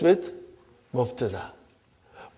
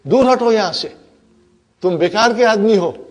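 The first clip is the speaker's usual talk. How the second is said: loud, higher mock scolding; scornful dismissal; 4 semitones higher, 5 dB louder, faster than usual. Inna pushes lam out in Urdu, scornful. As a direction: shoo a useless person away.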